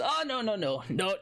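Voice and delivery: put-on flustered old dwarf. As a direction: brush off the topic in a panic. Nervous old dwarf protests.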